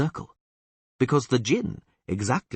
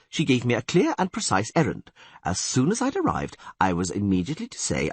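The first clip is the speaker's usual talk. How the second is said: ordinary delivery, nothing marked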